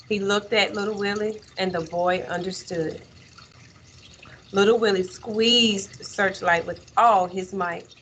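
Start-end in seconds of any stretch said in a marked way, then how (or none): none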